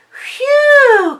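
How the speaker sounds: high, loud puff of relief; gasping phew of relief; relieved gasp. A narrator's reading. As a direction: blow out a big phew. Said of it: "gasped Mommy".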